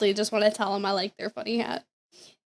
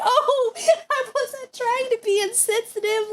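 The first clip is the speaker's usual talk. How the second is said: laughing apology; 11 semitones higher than usual. Retold or imitated.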